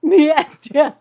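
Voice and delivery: "Master" loud and high, lisped in a put-on accent. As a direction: shout "Master" in a silly lisping accent. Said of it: "And an accent with a lisp".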